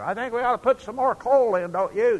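yelling to a mate beside a roaring firebox; shouting over noise. Retold or imitated. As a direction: shout to be heard over a steam engine. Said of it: "you're shouting at the top of your voice".

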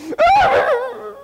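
high wailing mock sob; hammed-up high sob; mock crying. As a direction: imitate a grown man bursting into tears. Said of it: "this guy starts crying".

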